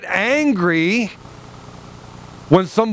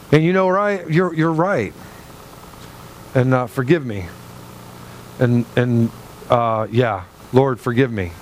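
humble repentance; 6 semitones lower than usual. Retold or imitated. Preacher models a humble believer, lower and contrite. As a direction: sound like someone gently owning their fault before God.

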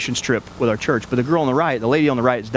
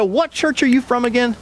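lightly voiced woman, pitched higher; a hint of the curious woman on the bench, higher up; voicing the woman; 8 semitones higher than usual. Woman on the bench asking the pastor, lightly voiced and higher. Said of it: "She said".

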